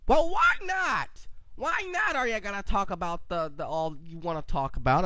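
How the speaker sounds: very high, soft squeaky voice; high mock protest. Podcaster voicing himself as a listener objecting, high mock protest. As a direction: put on a small, high voice to heckle yourself. Retold reaction to his own podcast.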